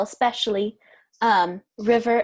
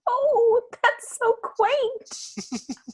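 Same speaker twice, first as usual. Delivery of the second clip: very high, drawn-out gush; melting, high-pitched delight; adoring coo; 13 semitones higher, much slower than usual. Real, not acted. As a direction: coo over a sweet animal. Player melts at nuzzling dog.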